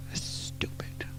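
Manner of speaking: breathy whisper, almost off mic; whispered aside. Real, not acted. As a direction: mutter it under the breath as an aside. Muttered aside to himself.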